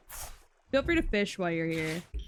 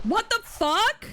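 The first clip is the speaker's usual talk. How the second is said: much higher, slow, disbelieving What the fuck; louder, higher shocked What the fuck; incredulous high; 12 semitones higher, 4 dB louder, slower than usual. Real, not acted. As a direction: stretch it up in disbelief. Repeated exclamation, higher.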